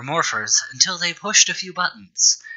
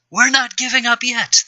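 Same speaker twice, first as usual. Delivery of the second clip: high, loud heroic shout; defiant shout; 11 semitones higher, 3 dB louder than usual. Acted. Teen in red defying Necron, high defiant shout. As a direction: yell it back at the villain, cheesy and brave. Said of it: "the teen in red shouted".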